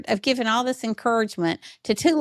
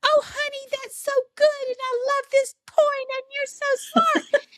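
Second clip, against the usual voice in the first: much higher, syrupy mock-praise voice of mom; son mimicking mom. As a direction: parody mom's gushing praise, sing-song and high. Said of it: "he's imitating me".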